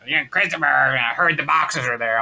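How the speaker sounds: mom's voice, stretched out; smoker mother's voice. Retold or imitated. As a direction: imitate a smoker mother on the phone. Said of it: "I always wanna do her voice".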